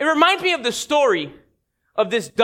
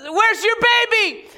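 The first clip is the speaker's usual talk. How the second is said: higher, bright-eyed show-off dog voice; a proud mother dog showing off her pups; perky dog voice; 10 semitones higher than usual. Acted. Mother dog bragging to the elephant, high perky voice.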